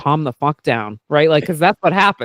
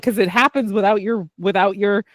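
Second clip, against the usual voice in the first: normal talking voice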